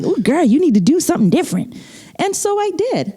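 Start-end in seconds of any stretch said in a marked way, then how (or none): 0.0-1.8 high, quick imitation of a sassy peer; playful mock scolding, pitched up; sassy schoolmate. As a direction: mimic a classmate giving attitude. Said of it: "they had the body movements and like".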